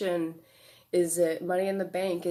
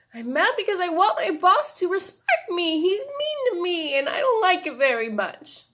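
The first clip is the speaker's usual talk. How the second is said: put-on high, loud little-kid voice, upset; inner child voice; 11 semitones higher, 8 dB louder than usual. Shown as a demonstration. Therapist voicing her inner child, a high, upset little kid. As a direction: speak as an upset five-year-old, high and loud. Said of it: "have your innocent little child, five-year-old self answer as if they actually had a voice".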